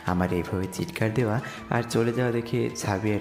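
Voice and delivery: ordinary delivery, nothing marked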